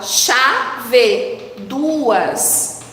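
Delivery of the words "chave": "Chave" as two even beats, CHA, VE; syllable by syllable. Shown as a demonstration. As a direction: count out both syllables of "Chave". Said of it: "Quantas sílabas eu tenho nessa palavra?". ("Chave" bem separado em sílabas: cha, ve)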